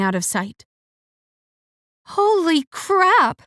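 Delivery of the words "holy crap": alarmed. A narrator's reading. Sudden alarm at danger.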